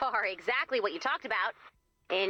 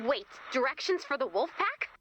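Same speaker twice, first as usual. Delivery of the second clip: ordinary delivery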